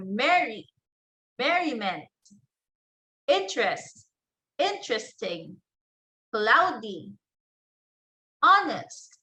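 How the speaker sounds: first syllable stressed in each word, as in "merry" and "merriment"; stress on root syllable. Shown as a demonstration. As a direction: keep the stress on the root, like HON in "honest" and "honesty". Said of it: "will retain the stress on the syllable".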